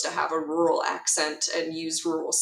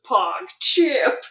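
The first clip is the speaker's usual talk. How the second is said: loud, higher-pitched character catchphrase; Mirio's exclamation; 7 semitones higher, 6 dB louder than usual. Acted. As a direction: burst out with it like a cartoon hero. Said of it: "Mirio comes through the ground and he goes".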